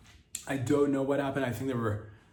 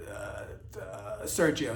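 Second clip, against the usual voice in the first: normal talking voice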